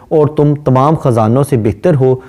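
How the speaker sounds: usual speaking voice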